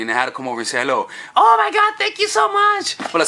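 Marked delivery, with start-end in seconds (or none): 1.4-3.0 much higher, swooning thank-you; flattered girl. Acted. Woman thanked for a compliment, high gushing voice.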